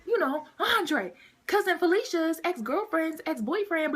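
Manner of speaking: high, loud, animated recognition; excited best friend. Retold or imitated. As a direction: play the best friend excitedly placing someone, voice up. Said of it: "He's like".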